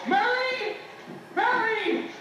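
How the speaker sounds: high, urgent call upstairs; excited, drawn-out name call; calling for wife. Acted. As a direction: holler eagerly for your wife. Searching house, calling his wife.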